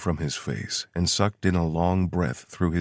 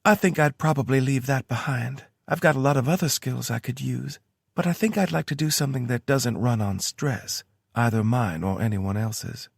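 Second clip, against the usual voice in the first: lightly higher woman's voice; Tara voice; 8 semitones higher than usual. A narrator's reading. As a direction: read her lines a bit higher. Tara's voice in story.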